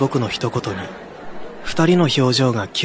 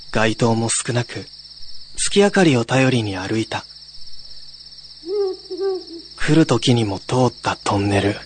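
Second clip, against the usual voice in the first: story narration. A narrator's reading. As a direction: draw the listener into the night. (怪談の語り口)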